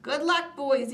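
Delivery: high, loud farewell call; cried out. A narrator's reading. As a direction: call it out to the boys. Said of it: "he cried".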